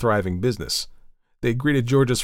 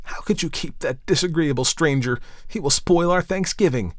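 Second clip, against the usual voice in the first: higher-pitched indignant protest; upset exclamation; 6 semitones higher than usual. A narrator's reading. Daughter objects to the disagreeable stranger, exclaiming in dismay. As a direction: protest to mother in a raised pitch. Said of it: "she exclaimed".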